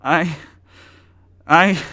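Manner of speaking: high, loud broken syllables; speechless fear. Acted. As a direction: choke on the words. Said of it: "unable to form a response".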